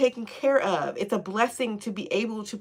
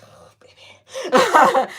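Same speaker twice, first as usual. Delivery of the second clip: ordinary talk, nothing marked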